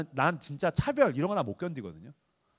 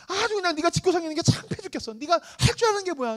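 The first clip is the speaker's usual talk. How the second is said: high nagging mom voice; mock scolding mom; 10 semitones higher than usual. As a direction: nag the son like an exasperated mother. (아들에게 잔소리하는 엄마 흉내, 높은 목소리로)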